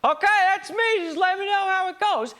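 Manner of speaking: high breezy mock voice; mocking future self. Mocking the ideal future self, high breezy voice. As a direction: toss it off like a careless dreamer. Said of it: "pass me the buck like".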